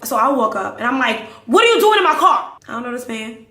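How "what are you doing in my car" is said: high, loud indignant demand; confrontational yell. Retold or imitated. She confronts the man in the car, high indignant yell. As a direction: yell it at an intruder.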